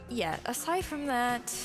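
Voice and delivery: ordinary delivery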